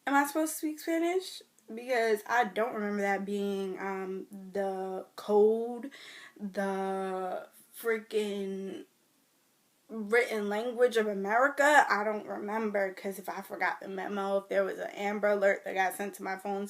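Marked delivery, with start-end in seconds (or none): 0.1-11.9 indignant. Real, not acted. Indignant rant about language.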